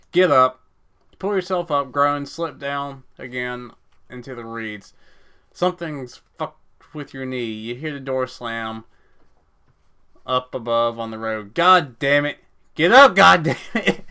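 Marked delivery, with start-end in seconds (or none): 0.1-0.6 loud barked get up; urgent command. Acted. Narrator urging hurt character to rise. 11.5-14.1 loud, higher effortful cursing; straining curse. Acted. Injured runner cursing himself to his feet.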